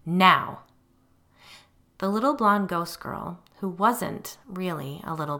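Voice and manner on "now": loud one-word order; firm demand. A narrator's reading. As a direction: cut off all argument. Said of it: "I demanded".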